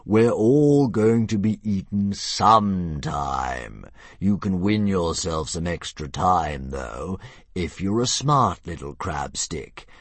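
slow, grand dragon; a lazy monster toying with prey. Acted. Narrator voices the dragon.

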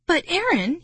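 high-pitched, exasperated objection; protesting. A narrator's reading. Marty to Aaron over a risky idea, protesting. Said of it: "he protested".

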